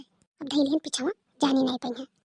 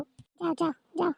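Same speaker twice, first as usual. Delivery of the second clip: dismissive aside; 8 dB quieter, slower than usual. Acted.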